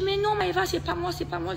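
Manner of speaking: high, hurried protesting; imitated panicked denial. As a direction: imitate a cornered friend insisting she is innocent. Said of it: "elle commence à bégayer". (dénégation affolée imitée)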